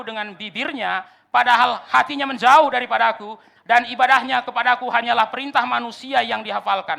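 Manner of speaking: loud preacher declaiming a verse; ringing, loud pulpit recitation; forceful scripture quote. Acted. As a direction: proclaim the verse with full force. Quoting scripture at full force.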